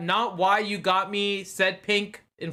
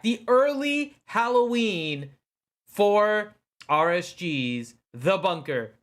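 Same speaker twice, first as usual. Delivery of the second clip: ordinary talk, nothing marked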